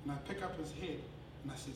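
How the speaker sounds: usual speaking voice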